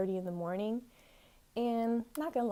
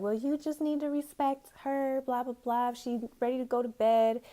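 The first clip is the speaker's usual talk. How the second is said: higher-pitched mock lecturing voice; imitated hall monitor; 5 semitones higher than usual. RA telling a student to respect her roommate, mocking imitation. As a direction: mimic someone dismissively laying down rules. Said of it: "my R A was just basically like".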